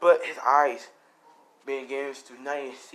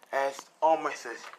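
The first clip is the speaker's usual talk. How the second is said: ordinary delivery, nothing marked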